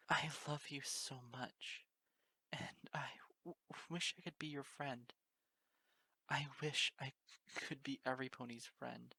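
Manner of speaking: weeping. Acted. Weeping pony in the mare's embrace, whispering through sobs. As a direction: break down, whispering through tears. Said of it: "I wept into the blind embrace".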